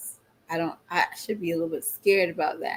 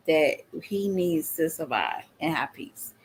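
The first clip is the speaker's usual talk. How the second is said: whisper; 3 semitones lower, 4 dB quieter than usual. Real, not acted. Whispered aside about her man.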